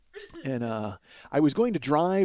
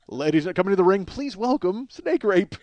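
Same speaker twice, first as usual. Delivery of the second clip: ring announcer; 5 semitones higher than usual. Shown as a demonstration. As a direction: stretch every word like a ring announcer. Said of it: "That doesn't happen in wrestling".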